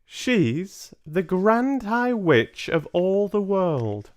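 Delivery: high, loud, slow revelation; ominous grandmother. Acted. Grandmother revealing the Grand High Witch, slow ominous voice. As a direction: unveil the secret with weight.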